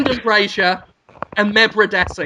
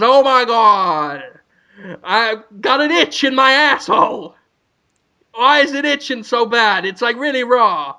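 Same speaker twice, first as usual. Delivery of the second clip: mock panic; 4 semitones higher than usual. [name] panics about itch.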